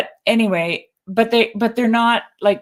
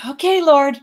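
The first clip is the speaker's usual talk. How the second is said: mock prayer; 8 semitones higher, 5 dB louder than usual. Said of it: "I just, like".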